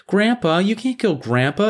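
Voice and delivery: high-pitched mimicked pleading; mock protest. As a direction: parody her objection in a high voice.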